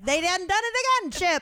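high, fast mock country voice. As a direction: do a goofy folksy complaint. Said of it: "and I'm like".

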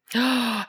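quick, high intake of breath; real startled in-breath; surprised gasp. Real, not acted. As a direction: gasp with delighted surprise at news. Listener surprised son was there.

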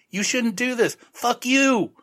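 high, squeaky cartoon voice; mock high-pitched Ed. Ed mocked, warning Frank in a high cartoon voice. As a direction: pitch it way up like a silly sidekick. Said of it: "Ed, is like".

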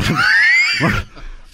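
a very high, breathy shriek of laughter. Real, not acted. Host shrieks with laughter at the joke. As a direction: squeal with laughter.

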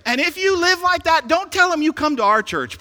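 high, loud playful rebuke; mock scolding. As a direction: pretend to tell the congregation off.

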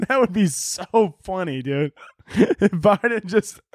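talking through real laughter; can barely get the words out for laughing. Real, not acted. Cracking up at his own joke.

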